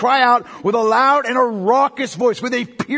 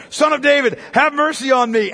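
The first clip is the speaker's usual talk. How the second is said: crying out; 5 semitones higher, 5 dB louder than usual. A narrator's reading. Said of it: "he cried out all the more".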